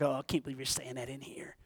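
hushed, low imitation of shocked listeners; very soft, low mock-offended murmur; mock disapproval. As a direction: drop to a small, scandalised aside. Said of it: "y'all looking at me like".

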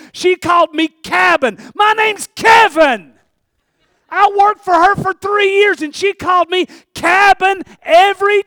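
loud, high and indignant. Real, not acted. Indignant retelling of nickname.

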